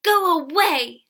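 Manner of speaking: slow, loud high shout; crying out. A narrator's reading. Villagers driving out the girl, crying out. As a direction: shout to drive someone off. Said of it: "the villagers cried".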